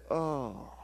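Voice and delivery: very soft, drawn-out dismay; disgusted sigh. Acted. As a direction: let it sink into a slow, heavy whisper. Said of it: "It's like this".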